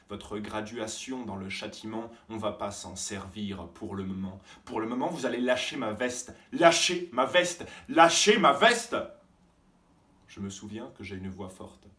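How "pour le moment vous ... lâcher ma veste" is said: furious shout. Acted. Student yelling at the teacher to let go, furious. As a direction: scream it at him, rising.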